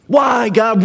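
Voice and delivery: pleading cry at God, pushed up; pretend scream. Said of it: "you start screaming".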